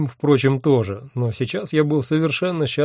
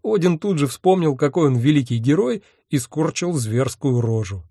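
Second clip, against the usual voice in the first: plain reading voice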